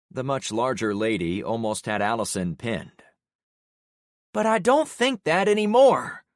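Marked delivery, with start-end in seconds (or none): 4.3-6.2 high, loud angry spit; spat. A narrator's reading. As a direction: spit it out while struggling. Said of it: "Allison spat".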